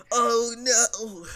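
high, loud comic wail; hammed-up cry of dismay; loud put-on despair, pitched high; mock dismay. Joking dub of dismayed reaction.

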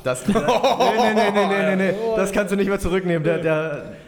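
high, loud laughing no no no; laughing protest. Real, not acted. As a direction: protest through laughter, drawn out. Teasing protest at opponent's remark.

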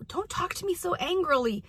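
mock-wounded little complaint; complaining child. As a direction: imitate a kid objecting, lightly. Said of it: "and they're like".

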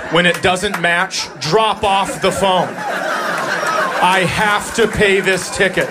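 very slow, drawn-out spelling-it-out delivery; slow exasperated. Real, not acted. Comic explaining to staff, slow exasperated. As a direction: stretch every word, fed up. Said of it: "acts out his frustrated instruction".

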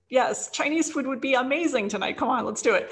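mock-persuasive sales pitch to a partner; playful coaxing. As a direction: sell the dinner idea, playfully eager. Coaxing partner about dinner choice.

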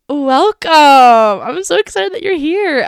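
high, loud, drawn-out gushing welcome. Acted. Host gushing a welcome to new listeners, hammed-up excitement. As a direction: greet the listener like a long-lost friend. Over-the-top podcast welcome.

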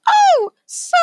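loud, extremely high falsetto squeak; Space Squirrel. Acted. Space Squirrel apologizes to the burly guy, piercing high squeal. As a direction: squeal the apology at the top of the range. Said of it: "so Space Squirrel goes".

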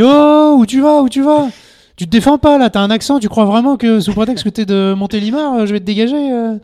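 crie bien plus fort et plus aigu sur le candidat; hurle-lui dessus pour le déstabiliser; engueulade jouée. Retold or imitated. Recrute en cassant le candidat. (juré de casting qui hurle sur un candidat, engueulade rejouée)

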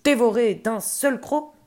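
loud ogre menace, acted out; annoyed threat. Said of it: "l'elfe s'énerva, grognon".

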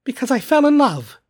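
high, loud, heartfelt answer; earnest nephew. A narrator's reading. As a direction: declare it openly in a lifted voice.

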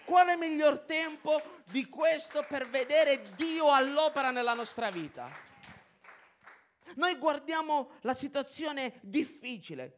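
loud, slow, full-force sermon cry; fiery preaching. Acted. Preacher rousing the church, loud and slow. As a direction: preach it out to the whole congregation.

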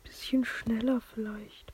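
slow soft whisper. Real, not acted. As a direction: murmur it to yourself, slowly. Cautiously steering the plane.

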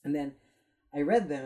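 ordinary talk

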